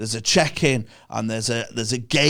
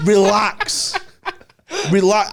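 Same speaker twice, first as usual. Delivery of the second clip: higher and louder, laughing in disbelief; laughing exasperated shout; 7 semitones higher, 5 dB louder than usual. Real, not acted. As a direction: laugh while shouting at them to relax. Laughing rant at airport security.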